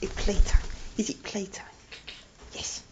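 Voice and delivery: soft, low, teasing whisper; a whispered invitation to play; playful whisper. Real, not acted. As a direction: hush it like a secret game. Whispering to a pet.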